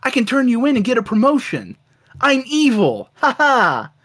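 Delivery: lightly voiced evil brother gloating; mock cartoon villain. The evil brother betraying Bromley, gleeful and hammy. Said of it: "his brother goes like".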